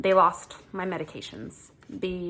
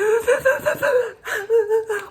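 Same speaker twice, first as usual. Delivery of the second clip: high crying gasp; crying patient gasping at the pharmacist; sobbing gasp; 6 dB louder than usual. Retold or imitated. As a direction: gasp through tears.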